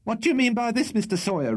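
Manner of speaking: very high, shrill demand; great shrillness. A narrator's reading. Landlady confronting her lodger from upstairs, shrill. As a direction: demand an answer in a shrill, scolding voice. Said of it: "with great shrillness and rapidity of utterance".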